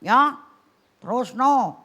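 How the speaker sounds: loud nagging push; nagging wife. Pretend. Wife loudly pressing her husband to go on and admit it. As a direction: press him insistently, loud. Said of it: "ibu-ibu terus ngomong".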